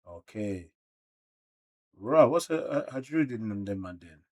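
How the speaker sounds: ordinary delivery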